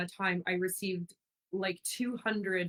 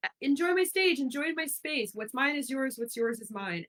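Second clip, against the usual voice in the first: much higher, delighted welcome; gushing hostess; 8 semitones higher, 4 dB louder than usual. Retold or imitated. Imitating herself inviting guests in, high and gushing. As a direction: throw the doors open to everyone.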